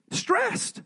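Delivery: sudden high, strained squeak of a word; stressed out. Acted. Pastor voicing Mary's panic over the wine, with a high strained squeak. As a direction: squeak it out like she's at her wit's end.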